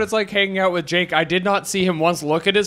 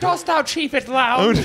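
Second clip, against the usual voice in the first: hammed-up olde-worlde question, slow and higher; mock archaic speaker; 6 semitones higher, slower than usual. Friend riffing on Game of Thrones, mock archaic. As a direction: draw it out in mock Shakespearean style.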